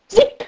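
high-pitched quick arrow zip; short, high sound effect of an arrow. A narrator's reading. Narrator imitates flying arrow.

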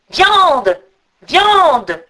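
loud, high, falling calls; eagle's cry. A narrator's reading. Eagle calls to its rider for food, loud swooping cry. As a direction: cry it out twice, long and swooping. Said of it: "l'aigle cria".

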